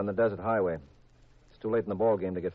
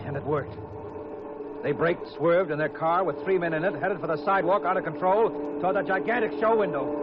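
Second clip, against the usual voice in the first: tense chase narration; 7 semitones higher than usual. A narrator's reading. Hard-boiled detective narrating the car chase, urgent. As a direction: drive the chase forward breathlessly.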